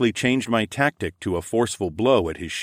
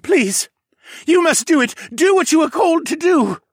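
high, loud, desperate plea; 8 dB louder than usual. A narrator's reading. Captured mage implores the priestess, desperate. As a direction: beg urgently, voice pitched way up. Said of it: "with desperation in his voice".

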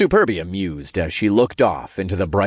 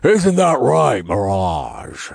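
teasing demon voice; chuckling; 3 dB louder, slower than usual. A narrator's reading. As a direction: prod her playfully as a big demon. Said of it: "Mammon chuckled".

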